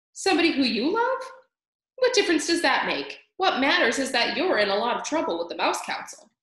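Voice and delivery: pushy, impatient Furlow; exasperated brother mouse. A narrator's reading. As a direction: scold your foolish little brother.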